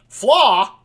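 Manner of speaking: loud, high-pitched disbelief; incredulous. Real, not acted. Host questioning the scientists' word flaw, incredulous. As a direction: throw it out as an outraged question.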